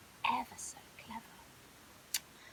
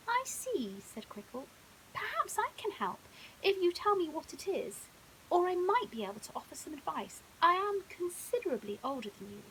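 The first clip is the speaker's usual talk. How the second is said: posh crocodile; 5 semitones higher, 5 dB louder than usual. Acted. Crickle offers help.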